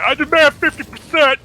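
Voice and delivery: mock demand. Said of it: "don't go in there and be like".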